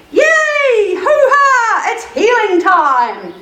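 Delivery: high, loud drawn-out cheering; excited yelling. A narrator's reading. Friends cheering that it's healing time, jubilant yell. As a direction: whoop it up, stretching the words. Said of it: "yelled his friend".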